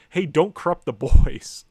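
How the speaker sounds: a loud, slow mock warning to workers; mock admonishing. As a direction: call it out slowly, like a poster's warning. Voicing the comic's warning to workers.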